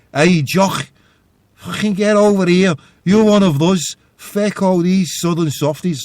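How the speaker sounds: imitated Scouse call, high and loud; shouted Scouse accent. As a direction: shout across the room as a Scouser. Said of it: "in a Scouse accent".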